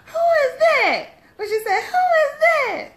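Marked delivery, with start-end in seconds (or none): 0.1-1.1 high shouted quote. Retold or imitated. Quoting movie line. 1.8-2.9 loud, high and laughing; high shouted quote. Retold or imitated. Quoting movie line.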